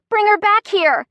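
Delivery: loud, very high furious demand; shrieking. A narrator's reading. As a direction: shriek it after the kidnapper. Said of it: "I hear Chrissy shrieking".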